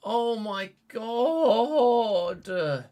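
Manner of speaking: very slow and high, disbelieving; drawn-out despair. Real, not acted. Gamer reeling after the red card, drawn-out despair. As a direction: stretch it out in exasperated despair.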